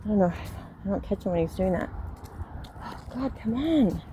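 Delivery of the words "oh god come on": higher exasperated come on; fed-up plea to the dog. Real, not acted. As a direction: owner fed up with her dog.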